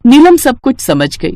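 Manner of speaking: usual speaking voice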